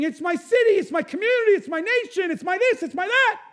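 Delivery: loud, high-pitched protesting list; mock blame-shifting. Preacher mimics people blaming everyone else, loud mock protest.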